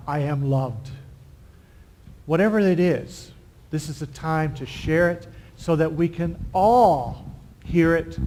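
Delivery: ordinary talk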